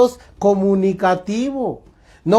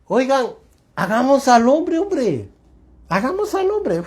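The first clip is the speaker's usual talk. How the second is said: God speaking; 5 semitones higher than usual. Retold or imitated. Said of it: "él dice".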